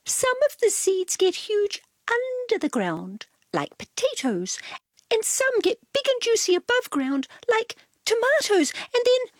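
prissy cat explaining; Cat's voice. A narrator's reading.

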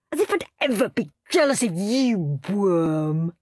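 contemptuous sneer with a hint of mockery. A narrator's reading. Henry sneering at his jealous little brother. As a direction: sneer down at him. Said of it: "sneered Henry".

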